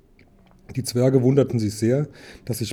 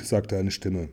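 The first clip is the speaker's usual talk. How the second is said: plain reading voice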